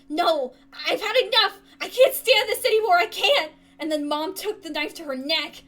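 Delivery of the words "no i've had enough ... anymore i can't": much louder, higher anguished cry; desperate mother. Acted. Mother at the end of her rope, anguished shout. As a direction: break apart with despair.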